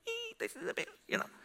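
tiny, very high mock-uneasy voice; awkward squeak. Preacher to himself as she cries, awkward squeak. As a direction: squeak it softly, as a comic inner thought. Acts out his awkward inner thought.

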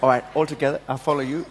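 usual speaking voice

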